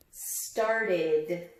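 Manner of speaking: ordinary talk, nothing marked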